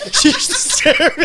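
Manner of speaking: high, loud, squeezed out through laughter; helpless laughter. Real, not acted. Host retells friend's misunderstanding to co-hosts, cracking up. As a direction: crack up while delivering the punchline.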